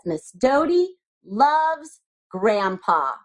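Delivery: slow read-aloud. Shown as a demonstration. Reading the card aloud.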